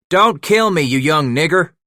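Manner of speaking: loud high joking holler; calling out. Acted. Old trapper hollering at his young partner, loud joking call. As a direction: yell a rough greeting from far off.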